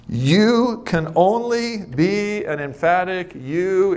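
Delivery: YOU drawn out long and high; weighty, slow stress on YOU; emphatic. Real, not acted. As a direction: stretch YOU, then pace each word slowly and deliberately. Preacher hammers home the key phrase.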